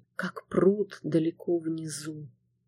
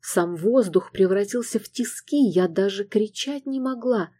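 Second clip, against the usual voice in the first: ordinary delivery, nothing marked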